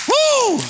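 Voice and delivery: a sudden high whoop of joy; high joyful woo. Real, not acted. Preacher whoops high to the congregation in joy. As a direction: let out a high woo of praise.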